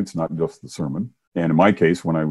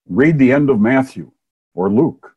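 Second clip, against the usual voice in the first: loud, raised voice from a dream; booming male voice; 7 semitones higher, 6 dB louder than usual. Acted. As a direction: boom it out like a command from above. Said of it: "booming male voice".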